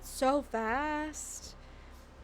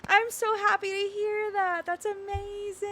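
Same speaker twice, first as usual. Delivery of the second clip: tender joy; 9 semitones higher, slower than usual. Real, not acted. Touched by adoption news.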